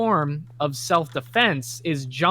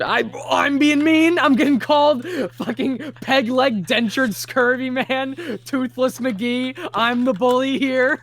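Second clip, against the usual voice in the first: high, loud indignant protest; 7 semitones higher, 3 dB louder than usual. Real, not acted. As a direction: protest in outraged disbelief. Indignant protest at being called bully.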